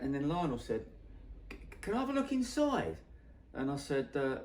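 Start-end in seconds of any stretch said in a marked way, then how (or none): 1.5-3.0 high-pitched tentative asking; hopeful request. Retold or imitated. Singer asking photographer to look inside, high and hopeful. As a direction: act out a curious request, pitched right up.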